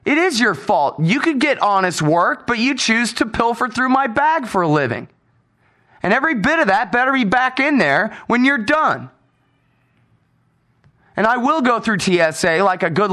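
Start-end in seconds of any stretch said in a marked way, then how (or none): 0.0-9.1 raised, biting scolding; retold angry scolding. Scolding the screener over his bag, indignant raised voice.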